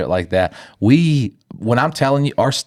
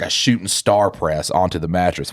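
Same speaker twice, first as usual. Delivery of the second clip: usual speaking voice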